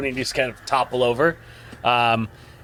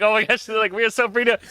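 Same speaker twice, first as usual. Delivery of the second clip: higher, light imitation of joking travellers; lightly acted breezy excitement; mock giddy chatter; 10 semitones higher, faster than usual. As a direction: play carefree and oblivious. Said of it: "kinda laughing and joking".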